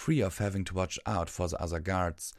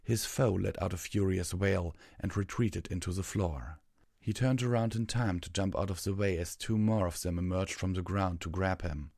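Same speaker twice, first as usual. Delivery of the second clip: ordinary delivery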